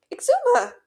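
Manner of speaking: high, nervous, too-loud hello; jumpy, pitch shooting up; wavering greeting. A narrator's reading. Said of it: "voice wavering".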